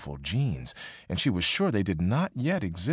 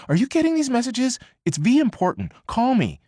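much higher and louder, frantic; 5 dB louder than usual. Acted. Annie's panicked text begging May to call, higher and louder. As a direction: demand she call right now.

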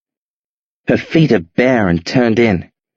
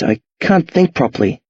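ordinary delivery, nothing marked

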